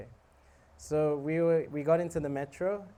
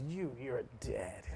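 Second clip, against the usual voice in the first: slow, quiet mock menace; understated angry threat; 3 semitones lower, 9 dB quieter, slower than usual. Angry drunk answers the horn, understated menace. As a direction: retell the threat softly and slowly. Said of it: "the angry guy goes".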